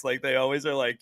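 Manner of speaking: normal talking voice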